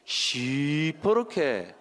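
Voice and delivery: drawn-out Gyeongsang teaching take; Gyeongsang dialect. Shown as a demonstration. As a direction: stretch the Gyeongsang word out for learners. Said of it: "어떻게 해요?".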